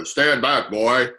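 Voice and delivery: loud stern command; commanding guard. Acted. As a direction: order him back firmly. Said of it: "Is that his voice?".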